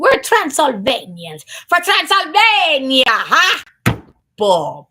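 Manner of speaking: hammed-up Transylvanian proclamation; triumphant shout. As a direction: crow it like a proud Transylvanian.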